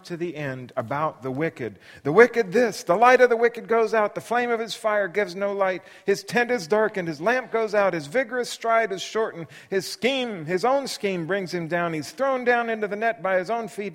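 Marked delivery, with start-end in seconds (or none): 2.0-13.9 higher, louder sermonizing rant. Acted. Bildad listing the fate of the wicked to Job, loud rant. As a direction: hammer each line home.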